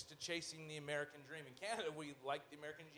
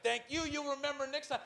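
higher, louder, smug mocking thank-you; smug rat; 10 semitones higher, 6 dB louder than usual. Rat busker gloats at the guy he just shook down. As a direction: gloat while pocketing the cash. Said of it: "And he's like".

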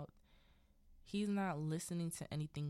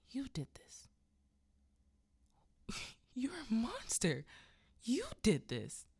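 high, acted accusation building louder; horrified accusation; 6 semitones higher, 4 dB louder than usual. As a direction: retell a mother turning on her husband, horrified. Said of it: "horrid. She's like".

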